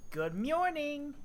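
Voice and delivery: cheery. Acted. Cheery greeting.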